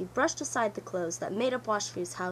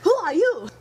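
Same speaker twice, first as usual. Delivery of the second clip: higher, louder startled yelp; frightened cry; 8 semitones higher, 11 dB louder than usual. Acted. Washfu, woken in the mud, cries out in fright. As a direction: jump up scared. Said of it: "starting up in a great fright".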